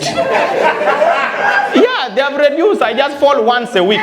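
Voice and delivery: high, laughing boast; high, pleased patient; proud patient. Retold or imitated.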